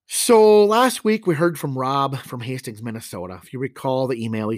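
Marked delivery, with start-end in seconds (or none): none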